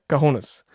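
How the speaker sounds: "Cojones" repeated in Spanish style, koh-HOH-nes; Spanish pronunciation. Shown as a demonstration. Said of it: "Spanish pronunciation".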